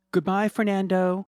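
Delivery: high called-out goodbye; a parting yell from afar; yelled farewell. A narrator's reading. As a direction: call it out after the car. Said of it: "someone yelled".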